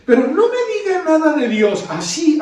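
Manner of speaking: hammed-up refusal to hear about God; mock protest. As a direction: imitate a churchgoer waving off talk of God. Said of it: "me dicen".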